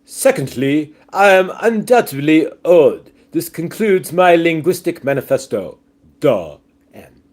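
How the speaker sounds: pompous British manifesto reading; hammed-up proper English voice; mock proper English accent. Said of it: "sounds like the right accent for that".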